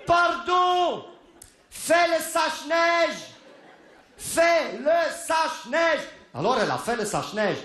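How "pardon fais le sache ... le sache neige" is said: high, slow yelling after her; shouting down the slope; panicked shouting. Retold or imitated. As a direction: call her back in alarm. Shouting after wife sliding away.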